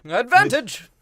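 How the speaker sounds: high, loud hammed-up shout; mock catchphrase. Cohost quoting the movie catchphrase, loud hammed-up yell. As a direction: bellow it like a cartoon villain.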